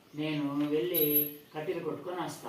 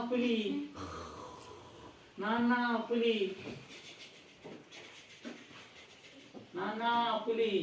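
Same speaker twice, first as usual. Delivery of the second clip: desperate cry for help; 10 semitones higher than usual. Acted. Boy really begs his father to come, terrified calling. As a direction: scream for help as the tiger comes.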